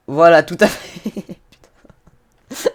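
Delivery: loud, drawn-out words collapsing into laughter; real helpless laugh, the swear word barely voiced; breaking into laughter. Real, not acted. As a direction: blurt it loudly, then crack up. Vehicle goes wrong, he cracks up.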